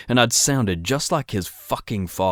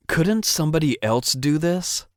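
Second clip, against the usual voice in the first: higher, whining complaint; petulant whine pitched up; whiny; 8 semitones higher than usual. Acted. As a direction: whine it like a tired brat. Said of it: "I could hear the whine in my voice".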